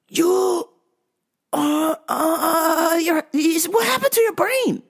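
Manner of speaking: high, stammering bewilderment; baffled disbelief. Retold or imitated. Clerk baffled by customer's sudden decline, high incredulous stammer.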